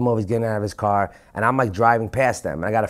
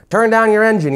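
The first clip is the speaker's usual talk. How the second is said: mock loudspeaker shout, much higher and louder; cop's loudspeaker; 11 semitones higher, 7 dB louder than usual. Cop orders the fleeing driver over his loudspeaker, mock loudspeaker shout. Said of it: "on his fucking loudspeaker".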